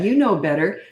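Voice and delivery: normal talking voice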